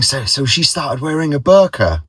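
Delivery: the fooled colleague asking eagerly; eager question. Retold or imitated. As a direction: ask eagerly, fully taken in. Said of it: "he was saying".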